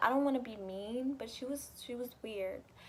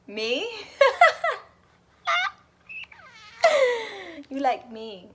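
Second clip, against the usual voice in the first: laughing disbelief; 11 semitones higher, 11 dB louder than usual. Real, not acted. Reacting to a confession.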